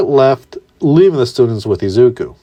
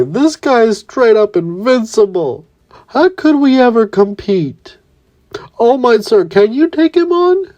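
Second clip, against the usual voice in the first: high, whiny panicked voice, much higher; Minetta's voice; 10 semitones higher than usual. Acted. Minetta begging All Might, high and panicky. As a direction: squeal it out in fear of an invincible foe. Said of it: "Minetta would say".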